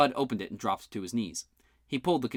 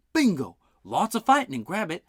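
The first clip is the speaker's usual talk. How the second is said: louder, excited exclamation; 8 semitones higher, 6 dB louder than usual. Acted. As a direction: pop with a bright idea.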